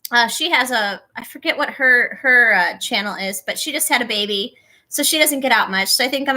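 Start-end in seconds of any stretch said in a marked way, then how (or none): none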